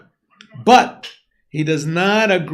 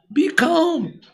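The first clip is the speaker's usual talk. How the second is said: much higher, put-on voice telling them to calm down; a sly, high hush; condescending mock calm; mock soothing Amalek; 10 semitones higher than usual.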